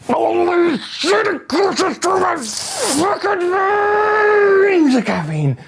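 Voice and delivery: very high slow loud yell; manic rush shout. Acted. Acting out caffeine coursing through his veins, shouting. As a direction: let the caffeine rush explode.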